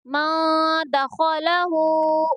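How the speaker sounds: "मौ द खौ ला हु" slowly, full-mouthed खौ and long हु; "मौ द खौ ला हु" with a held nasal first and drawn-out हु; stretched ending. Shown as a demonstration. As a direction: chant "मौ द खौ ला हु", holding the last vowel. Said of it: "इसको थोड़ा खींच कर पढ़ेंगे".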